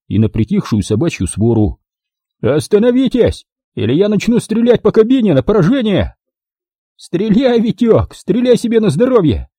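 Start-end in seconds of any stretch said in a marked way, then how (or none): 2.4-6.2 high-pitched threatening shout; shouted warning. A narrator's reading. Owner warns the men in the bulldozer, shouting. 7.0-9.5 high-pitched, gloating call; triumphant taunt. A narrator's reading. Max dares the owner to shoot, triumphant. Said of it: "торжествующе хохотнул Макс".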